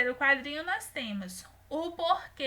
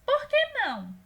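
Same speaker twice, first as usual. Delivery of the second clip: high, loud indignant question; higher, louder character question; comic strip character asking; 10 semitones higher, 5 dB louder than usual. Acted. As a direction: voice the girl's pointed question. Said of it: "Ao lado nós temos".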